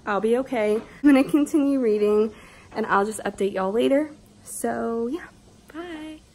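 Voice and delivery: ordinary talk, nothing marked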